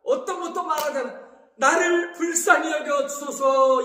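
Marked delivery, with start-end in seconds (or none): 1.6-3.8 slow, loud acted plea; beseeching prayer. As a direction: stretch it out as a loud cry for mercy. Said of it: "이렇게 기도했어요". (길고 크게 부르짖는 기도)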